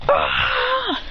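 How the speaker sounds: high, long imitated scream; mock scream of pain. Sasuke stabbed in the genjutsu, high mock scream. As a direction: wail high, acting it out.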